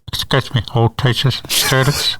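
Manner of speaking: muffled PA announcement; over the intercom. Retold or imitated. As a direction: speak as if through a school PA. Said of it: "I'd talk like this".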